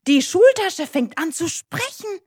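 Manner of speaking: high, loud storytelling astonishment; amazed narration. A narrator's reading. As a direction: announce the wonder with rising excitement. Narrator builds up a magical surprise. (staunend und aufgeregt erzählt)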